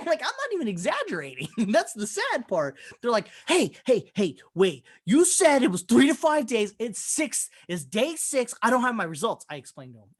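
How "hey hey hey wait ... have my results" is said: lightly imitated indignant complaint, pitched up; mock irate caller. Patient on day six demanding test results, mildly indignant. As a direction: mildly protest like an impatient caller.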